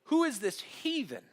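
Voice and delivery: high-pitched acted outrage; upset, indignant. David protesting insults to Israel's God, upset and indignant. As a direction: demand an answer, voice pitched well up. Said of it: "But David's upset".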